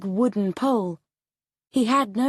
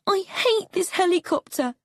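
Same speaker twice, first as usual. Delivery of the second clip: put-on much higher, whiny child voice; frightened boy; 11 semitones higher than usual. A narrator's reading. Boy telling his father he hates the helicopter. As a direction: complain like a scared nine-year-old.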